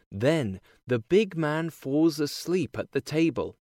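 ordinary delivery, nothing marked

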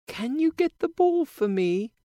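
put-on high, bright girlish princess voice; cartoon princess. A narrator's reading. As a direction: play a sweet young princess. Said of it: "she asks the frog".

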